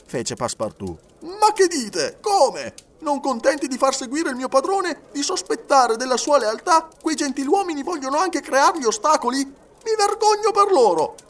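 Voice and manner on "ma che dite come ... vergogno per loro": very high, loud protest; indignant outburst. A narrator's reading. Servant defending his master to the detective, indignant. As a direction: rail indignantly in a raised character voice.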